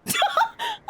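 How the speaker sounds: high-pitched burst of laughter; shrieking laugh. Real, not acted. Player cracking up at game dialogue, shrieking laugh.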